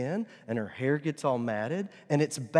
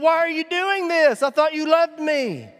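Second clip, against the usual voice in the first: high, loud wail of protest; hammed-up high doggy outcry; betrayed dog; 10 dB louder than usual. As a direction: plead like a wronged little pet. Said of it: "She's looking at me going".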